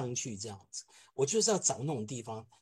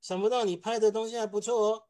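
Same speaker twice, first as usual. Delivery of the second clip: higher, teasing grudging compliment; grudging praise; 6 semitones higher, 3 dB louder than usual. Retold or imitated. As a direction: praise him half unwillingly. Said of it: "瞪了我一眼说".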